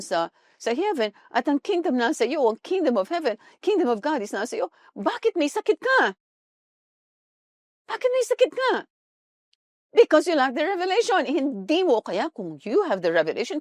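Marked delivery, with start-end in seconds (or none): 4.9-6.1 pointed rhetorical question. Acted. Preacher's rhetorical question to congregation. 7.9-8.7 much higher, disbelieving repeat; incredulous. Acted. Preacher repeats question, incredulous.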